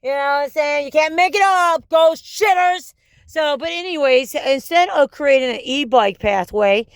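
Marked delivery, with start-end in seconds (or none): 0.9-2.9 exasperated shout. Real, not acted.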